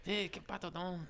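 high, soft, dramatic Mexican dad voice; dramatic Spanish dad. Pretend. Mocking a friend's dad's Spanish, high and soft. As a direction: ham up the father's reply in Spanish. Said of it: "So it sounds more dramatic".

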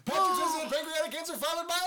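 race caller. Pretend. Mock race call.

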